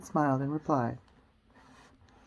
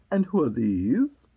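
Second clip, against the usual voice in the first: slow, loud regal demand; imperious Queen; 4 semitones lower, 5 dB louder, slower than usual. Acted. Queen pointing at the gardeners, slow and imperious. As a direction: draw each word out loudly. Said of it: "said the Queen".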